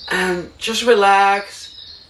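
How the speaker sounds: drawn-out, soothing "relax"; slow soothing reassurance; slow calming voice. Shown as a demonstration. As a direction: ease the listener down slowly. Calming advice to viewers.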